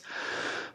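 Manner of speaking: long, breathed-out sigh; soft, tired exhale; weary sigh. Acted. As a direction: sigh as you finally sit down. Exhausted character sits down, sighs.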